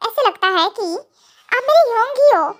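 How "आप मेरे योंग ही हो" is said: imitated girl's voice. Kookie calling him her Yong, imitated girl's voice. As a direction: lift the voice lightly into hers.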